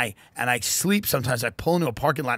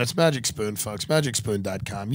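normal talking voice

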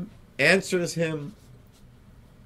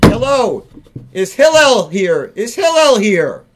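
loud, high call at the door; 4 semitones higher, 7 dB louder than usual. Acted. Pestering man calls for Hillel at the door, loud. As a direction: holler for Hillel again, impatient. Man knocks a third time.

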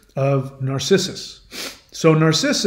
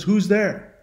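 higher-pitched echo at modest volume; high unforced echo of a call; Echo repeating; 4 semitones higher than usual. Retold or imitated. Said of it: "Echo can only repeat what you've said".

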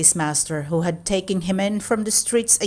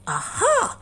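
sudden idea. Acted. Cat gets an idea.

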